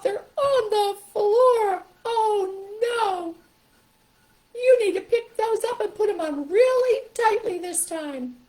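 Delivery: very high, loud puppet voice; loud, high, hammed-up toy animal; Stuffy. Shown as a demonstration. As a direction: wail it as a dismayed puppet, stretching the words. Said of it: "here comes Stuffy or the puppet".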